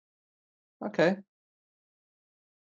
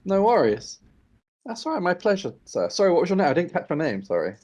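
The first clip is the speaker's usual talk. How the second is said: higher polite adventurer; 6 semitones higher than usual. Acted. Player voices character, raised pitch.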